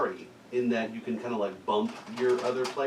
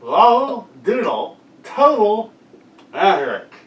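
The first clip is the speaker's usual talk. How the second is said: slower, louder repeat through a mouth guard; 7 semitones higher, 12 dB louder, slower than usual. Real, not acted. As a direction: spell it out loudly. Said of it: "how I repeat that".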